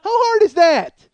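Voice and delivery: high, loud, slow fake innocence; hammed-up fake surprise; mock surprise. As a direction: play dumb like you just woke up. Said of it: "pretend to be asleep until the end and then go".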